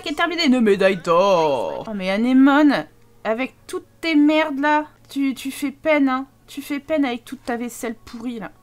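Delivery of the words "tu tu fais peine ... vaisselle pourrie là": usual speaking voice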